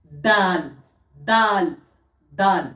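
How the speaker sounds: দাল with tongue tip on the gum ridge; careful letter drill; Arabic letter demo. Shown as a demonstration. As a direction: repeat দাল clearly. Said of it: "দাল যখন বলবো".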